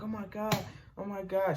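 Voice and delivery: high, breathless-sounding mock panic; retold alarmed mother, softly voiced; mom panicking. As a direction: play the frantic mom, lightly. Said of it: "she was going crazy".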